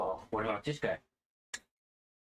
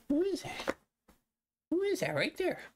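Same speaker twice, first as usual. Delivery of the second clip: much higher squeaky voice for the dog; squeaky pet voice. Real, not acted. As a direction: squeak it up like talking to a puppy.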